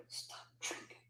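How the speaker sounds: urgent whisper. Retold or imitated. Wife whispering to husband to stop drinking, urgent whisper. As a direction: hiss it so the hosts can't hear. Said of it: "I'm over here".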